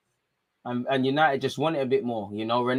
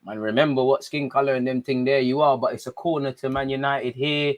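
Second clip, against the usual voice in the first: normal talking voice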